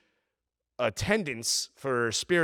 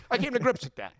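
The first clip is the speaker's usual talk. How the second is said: worked up; 8 semitones higher, faster than usual. Real, not acted. Venting about losing luggage.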